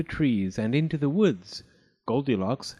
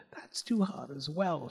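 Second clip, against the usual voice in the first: put-on disappointed girl; 2 semitones higher, 6 dB quieter than usual. A narrator's reading. Goldilocks tasting the medium bowl, softly put out. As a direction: complain softly.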